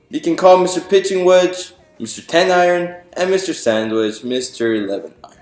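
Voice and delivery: plain reading voice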